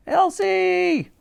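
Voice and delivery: high, excited shout of a name. A narrator's reading. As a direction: hail her from the bridge. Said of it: "they shouted on seeing her".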